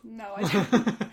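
laughing. Real, not acted. Laughing refusal.

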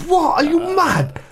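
high, incredulous what; can't believe he's running away; disbelieving. Retold or imitated. Boxer baffled as the opponent flees, pitch shooting up.

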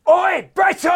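loud, very high sitcom impression; Frank Spencer. Comedian on request calling to the character's wife, high shout. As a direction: call out in a high, hapless shout. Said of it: "Okay, Frank Spencer".

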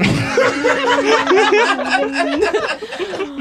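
high helpless laughter; squealing laugh. Real, not acted. Cast cracking up at the end of the sketch, high laugh. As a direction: collapse into laughter.

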